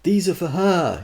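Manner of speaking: spelling it out impatiently; fending off a pest; exasperated. Acted. Arthur fending off woman.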